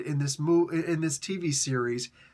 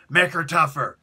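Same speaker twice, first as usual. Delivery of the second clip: loud curt boss command; boss order; 6 dB louder than usual. Retold or imitated. Boss telling the writer to toughen Dove, loud. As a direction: snap the order down. Boss Vince answering the pitch.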